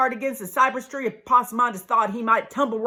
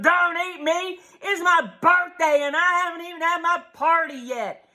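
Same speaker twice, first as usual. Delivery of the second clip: higher panicked holler; hollering possum; 7 semitones higher than usual. Acted. Possum hollering at the snapping turtle in panic. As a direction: holler for your life. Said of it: "that little possum hollered".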